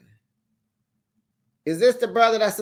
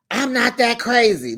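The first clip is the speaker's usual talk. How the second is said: louder, higher mock protest; imitating an indignant mother; mock indignant shout of a fired-up mother; 6 semitones higher, 4 dB louder than usual. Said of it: "That got Kate fired up".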